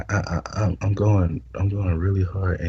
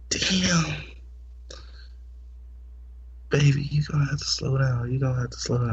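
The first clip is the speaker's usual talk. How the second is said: put-on high whispered girlfriend voice; imitating her; 7 semitones higher, 3 dB quieter, faster than usual. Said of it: "she's like".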